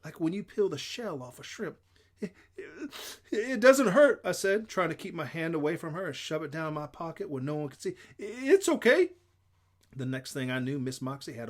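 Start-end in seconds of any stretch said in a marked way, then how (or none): 2.2-4.2 put-on upset child's wailing denial; tearful protest. A narrator's reading. Hurt child insisting, close to crying. 8.2-9.1 put-on high, loud child's protest; frantic insistence. A narrator's reading. Hurt child pulling away, insisting.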